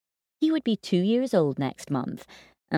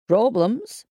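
dad voice; 5 semitones higher than usual. Acted. Dad enters, different voice.

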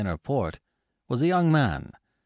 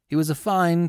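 ordinary delivery, nothing marked

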